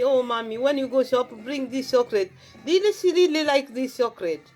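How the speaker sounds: usual speaking voice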